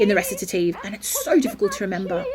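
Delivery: ordinary delivery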